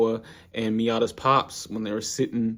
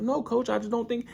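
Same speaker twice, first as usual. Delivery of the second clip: higher, timid protest; pleading Ippo voice; 13 semitones higher than usual. Retold or imitated. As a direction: squeak out a weak excuse. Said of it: "He's like".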